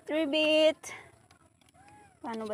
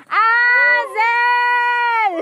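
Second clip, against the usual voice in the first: loud high shout of a name; calling out; 6 semitones higher, 7 dB louder than usual. Real, not acted. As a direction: yell the name excitedly. Calling out to a cousin.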